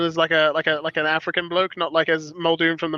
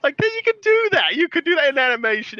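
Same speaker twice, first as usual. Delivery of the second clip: giddy excitement; 9 semitones higher, 3 dB louder than usual. Real, not acted. Giddy laughing aside.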